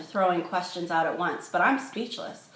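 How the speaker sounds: usual speaking voice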